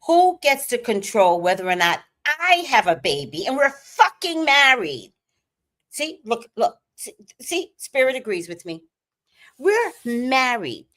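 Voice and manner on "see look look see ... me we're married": ordinary talk, nothing marked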